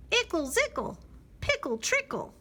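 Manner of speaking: high, loud singsong nonsense; bouncy high chirpy rhyme; silly bird song. Acted. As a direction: chirp it out gleefully.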